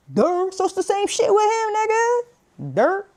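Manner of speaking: hyped up. Real, not acted. Hyped joking rant.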